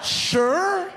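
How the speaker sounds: high, loud, laughing disbelief; incredulous echo. Retold or imitated. Comic echoes the bartender's sure, high laughing disbelief. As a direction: squeal the word back in disbelief. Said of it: "mocking the bartender's reply".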